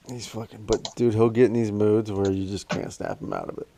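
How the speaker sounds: ordinary talk, nothing marked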